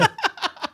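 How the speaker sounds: short high laugh. Real, not acted.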